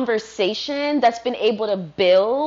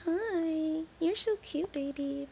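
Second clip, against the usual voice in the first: much higher and softer, cooing at a pet; 7 semitones higher, 11 dB quieter, slower than usual. Real, not acted. Speaking to her dog.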